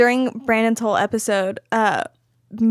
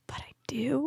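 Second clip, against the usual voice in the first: breath-only guilty admission; whispered confession; 3 semitones higher, 13 dB quieter than usual. Retold or imitated. Crew member admitting she wants to be involved, whispering. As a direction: whisper it like a shameful secret. Retold reluctant inner confession.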